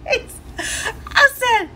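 high, slow, words breaking into laughter; can barely talk for laughing; laughing hard. Real, not acted. Wife telling her story, broken up laughing.

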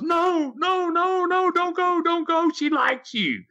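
high mock shouting crowd begging him to stay; high pleading shout of a zoo crowd. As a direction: call out like an excited crowd, no, don't go. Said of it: "the crowd goes".

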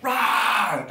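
drawn-out pretend roar; lion roar. Shown as a demonstration. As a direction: roar like a lion for little kids. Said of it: "What does a lion do?".

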